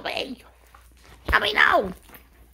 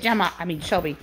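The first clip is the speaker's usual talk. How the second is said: ordinary delivery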